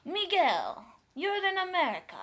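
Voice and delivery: high, loud mock reproach; friend's scolding voice. Sean challenges Miguel about his car, mock scolding. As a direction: call him out with pitch jumping up. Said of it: "but he said".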